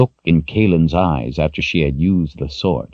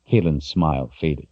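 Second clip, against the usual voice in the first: usual speaking voice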